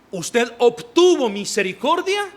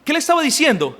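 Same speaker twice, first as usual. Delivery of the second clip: ordinary talk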